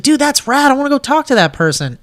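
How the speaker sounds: eager retold reaction. Host retelling his dream reaction, eager and excited.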